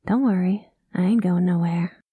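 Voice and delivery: ordinary talk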